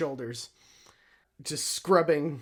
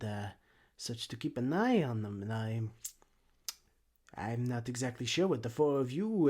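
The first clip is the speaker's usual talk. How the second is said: hesitant, suspicious busybody gnome; put-on accent, feeling out the strangers with pauses; softer, halting gnome character voice; gnomish exhibitor; 3 semitones lower, 4 dB quieter than usual.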